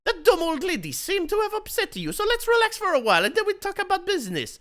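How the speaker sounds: high, fast hammed-up voice; high excited voice. Isaac urging Bruce to relax, high fast voice. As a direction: rattle it off high and eager.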